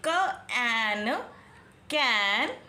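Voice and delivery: very slow sounding out of कैन; कैन with open ae; slow phonics. Shown as a demonstration. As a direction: drag each sound, then कैन. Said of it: "So ये देखिए".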